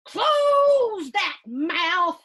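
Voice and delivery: slow, loud, high shouted order; stern shout. Acted. Principal ordering Melvin to stop whooshing, slow and loud. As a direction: bark it slowly at him. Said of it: "she shouts".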